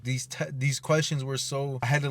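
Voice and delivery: normal talking voice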